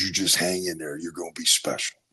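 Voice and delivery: soft, low imitation of a coach; hushed retold encouragement; close in the ear. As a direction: lean in and speak low, privately. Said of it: "his mouth gets right next to my ear".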